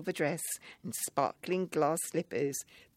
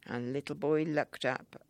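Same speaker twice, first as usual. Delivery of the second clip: ordinary delivery, nothing marked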